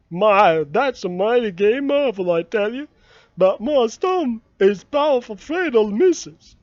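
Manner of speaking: tempted but still afraid; Jim, tempted. Acted. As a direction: Jim eyeing the marble, torn. Narrator voices Jim.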